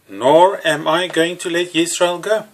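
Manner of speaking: ordinary talk, nothing marked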